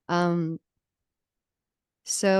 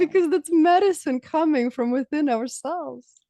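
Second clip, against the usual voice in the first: laughing reply; 9 semitones higher, 6 dB louder than usual. Retold or imitated. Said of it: "I'm like".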